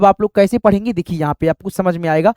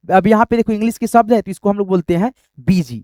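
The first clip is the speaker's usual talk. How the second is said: ordinary talk, nothing marked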